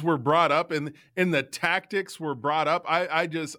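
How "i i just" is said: ordinary delivery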